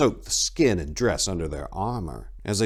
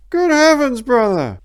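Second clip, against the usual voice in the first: high, loud relieved call; calling back; 9 dB louder than usual. A narrator's reading. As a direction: call it over from cover, higher and louder. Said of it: "the voice called back".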